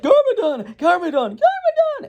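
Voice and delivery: hammed-up squealed alarm, rising each time; crowd of citizens. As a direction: act a whole city crying out. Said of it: "everyone in the city's like".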